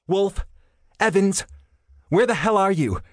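impatient radio bark. A narrator's reading. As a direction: bark the names over the radio. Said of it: "Sarah Connor's voice barked".

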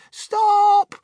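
very high, drawn-out loud shriek; panicked yell. A narrator's reading. Grandmother yelling to halt the rocketing lift, panicked yell. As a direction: yell it over a roaring wind. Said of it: "yelled Grandma Josephine".

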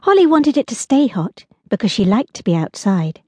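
ordinary delivery, nothing marked

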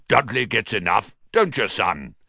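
hearty fatherly voice. A narrator's reading. Father checking with his well-fed son, hearty. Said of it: "said Uncle Vernon heartily".

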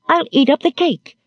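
put-on high-pitched little girl's threat; childish defiance. A narrator's reading. Little girl threatening to eat the cake, defiant.

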